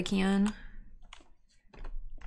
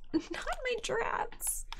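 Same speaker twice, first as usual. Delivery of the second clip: laughing plea; 12 semitones higher, 8 dB quieter, much slower than usual. Real, not acted.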